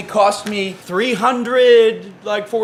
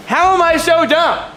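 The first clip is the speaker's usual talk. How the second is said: high, loud frustrated cry; exasperated shout; 11 semitones higher, 4 dB louder than usual. Real, not acted. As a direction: yell it at yourself. Frustrated self-scolding.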